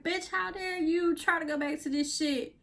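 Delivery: scolding inner voice. Retold or imitated. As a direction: snap at yourself like a sassy scold. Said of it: "you're so like".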